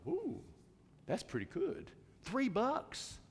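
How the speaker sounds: soft, high-pitched musing; quiet little "ooh" of interest; pleasantly impressed. Retold or imitated. Driver musing over a cheap car wash sign, pleasantly impressed.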